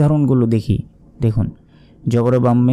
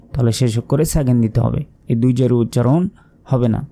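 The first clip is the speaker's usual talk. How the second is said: ordinary talk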